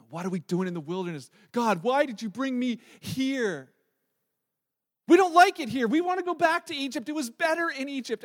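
rising, loud, whiny complaint of the Israelites; whining complaint. Retold or imitated. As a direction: moan like ungrateful people in the desert. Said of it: "They're like".

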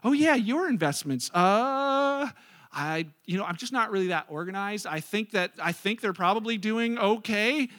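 stalling, drawn-out hesitant answer; evasive planner. Acted. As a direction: hedge like an unprepared financial planner. Acted evasive financial planner.

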